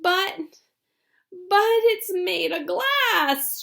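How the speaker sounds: put-on a frightened old man protesting; high slow old grandpa, worried; alarmed Grandpa Joe. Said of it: "shouted Grandpa Joe".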